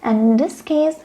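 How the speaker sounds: normal talking voice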